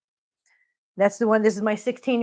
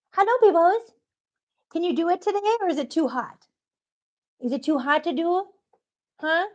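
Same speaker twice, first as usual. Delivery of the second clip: coaxing pet talk; 6 semitones higher than usual. Real, not acted. Coaxing bird to bob.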